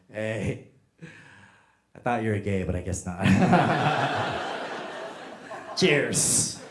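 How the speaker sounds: lightly voiced drunk toast, low; drunk best man. Drunk best man toasting the groom, lightly played drunk. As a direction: give a mildly tipsy, low-pitched toast. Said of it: "this one guy was so drunk".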